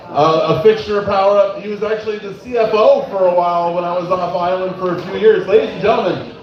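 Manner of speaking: booming club host announcement, building up; emcee intro. Acted. Host introducing the next comedian, booming emcee. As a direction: hype the next comic.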